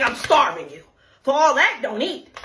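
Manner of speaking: usual speaking voice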